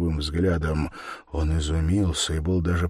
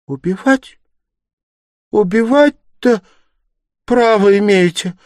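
put-on high, loud, appalled young woman; horrified outcry; 12 dB louder than usual. A narrator's reading. Sonya challenging the murderer's right to kill, horrified. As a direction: cry out in shocked disbelief, pitched way up. Sonya reacts in horror to him.

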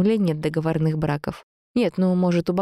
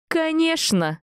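high-pitched emphatic outburst; exclaimed retort; 12 semitones higher, slower than usual. A narrator's reading. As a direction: fire it back in his face. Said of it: "быстро и громко выкрикнула я ему в лицо".

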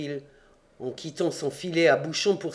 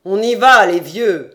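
loud, raised-voice reply; calling back; 3 semitones higher, 10 dB louder than usual. A narrator's reading. The bear answers those on the stairs, calling out. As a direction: shout back to the others. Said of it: "répondit à ceux de l'escalier".

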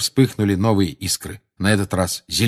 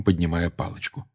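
ordinary delivery

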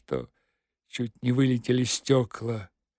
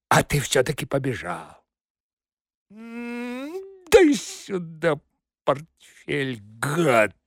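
menacing nasal thug voice; slower than usual. Acted. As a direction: snarl it through the nose. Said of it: "Тем самым гнусавым голосом".

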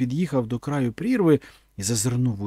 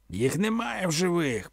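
warriors confirming; 6 semitones higher, 3 dB quieter than usual. A narrator's reading. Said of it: "підтвердило п'ятсот воїнів".

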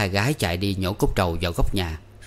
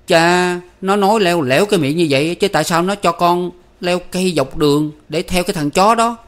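much higher, louder angry outburst; flaring up; 9 semitones higher, 7 dB louder than usual. A narrator's reading. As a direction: blow up in sudden anger. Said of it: "Tư Cầu bỗng nổi nóng".

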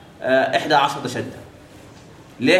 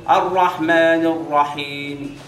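stretched Quran recitation with a faint final vowel; teacher demonstrating the hidden vowel slowly; much slower than usual. Shown as a demonstration. Teacher showing students the faint vowel, slowly. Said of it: "حتى يقول لك ايه؟".

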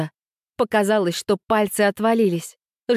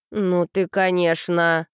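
usual speaking voice

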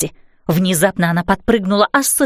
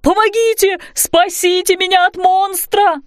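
wailing in fright; 8 semitones higher than usual. A narrator's reading. Roofer begging rescue from monster, wailing in fright. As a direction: cry for rescue high in a put-on frightened voice. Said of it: "завопил он".

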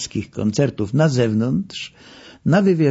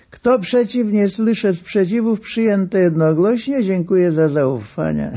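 high, pompous chairman closing a vote; mock chairman; 7 semitones higher than usual. Narrator mocks the star as a meeting chairman, high and pompous.

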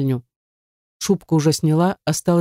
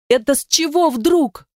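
high-pitched, drawn-out indignant question; flaring up; 10 semitones higher than usual. A narrator's reading. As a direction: flare up, voice leaping high. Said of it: "взвилась она". (взвившись, возмущённо)

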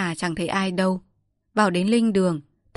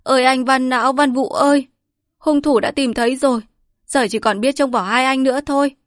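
high, loud wailing lament; crying out; 6 semitones higher, 6 dB louder than usual. Acted. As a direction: wail for the dead master. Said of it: "khóc giống lên".

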